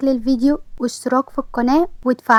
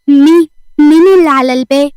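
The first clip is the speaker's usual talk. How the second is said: calling out; 2 semitones higher, 6 dB louder than usual. A narrator's reading. Frightened call through door.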